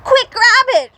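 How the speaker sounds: very high, loud urgent call; shout. Acted. Littlest rabbit to Rabbit, urgent shout. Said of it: "shouted the littlest rabbit".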